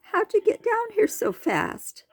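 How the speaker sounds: very high, startled asking; amazed question. A narrator's reading. The turtle asks Tor how he got there, very high and amazed. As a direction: ask in disbelief.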